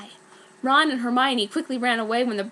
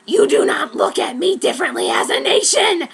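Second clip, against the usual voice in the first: higher, louder cheerful creature voice; happy Brom; 7 semitones higher, 8 dB louder than usual. Acted. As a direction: say it gleefully. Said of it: "Brom said the happy".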